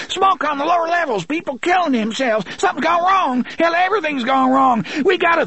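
high, loud, frantic shrieks; a terrified man screaming warnings as he runs; panicked screaming. Acted.

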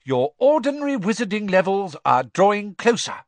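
high, loud stern professor; a strict teacher's warning; McGonagall. Acted. McGonagall lectures the class.

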